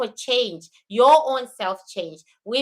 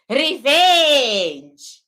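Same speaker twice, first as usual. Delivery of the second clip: Revenge rolled out slow in an African accent; drawn-out African-accented Revenge; 6 dB louder than usual. Shown as a demonstration. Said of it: "in my African accent".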